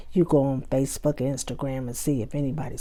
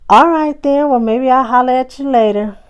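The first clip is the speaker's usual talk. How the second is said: bright sign-off; 7 semitones higher, 9 dB louder than usual. Pretend. Acted forced cheerful sign-off.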